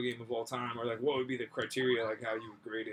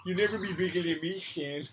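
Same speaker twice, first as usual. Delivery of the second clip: put-on high bratty kid voice, laughing; whiny bratty kid taunt; 4 semitones higher than usual. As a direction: taunt like a little brat. Continuing sibling taunt bit.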